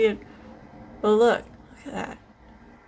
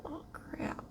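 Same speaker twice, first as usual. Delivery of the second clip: whispered curse; 7 semitones higher, 19 dB quieter than usual. Real, not acted. Small makeup slip.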